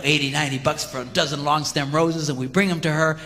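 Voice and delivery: ordinary talk, nothing marked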